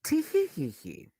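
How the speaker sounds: high, slow fake giggle; mock titter. Shown as a demonstration. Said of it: "I hee heed".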